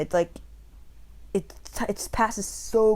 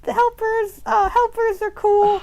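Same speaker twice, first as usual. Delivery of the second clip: put-on very high, slow, loud little-kid announcer; high squeaky kid voice; 8 dB louder than usual. Imitating his child self opening his old show, in a squeaky high voice. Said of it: "I'm gonna be like".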